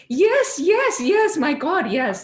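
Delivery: high, loud burst of eager yeses; excited yeses. Retold or imitated. To the palm reader, bursting with high excited agreement. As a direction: gush yes like he read your soul. Palm reading hits home.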